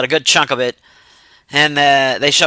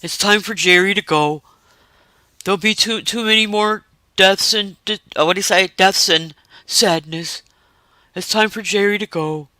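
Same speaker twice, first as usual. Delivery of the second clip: sad farewell from the kind dog; gentle dog; 3 semitones higher, 5 dB quieter than usual. Retold or imitated. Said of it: "the dog is like".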